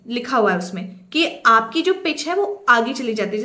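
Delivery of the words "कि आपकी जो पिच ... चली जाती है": usual speaking voice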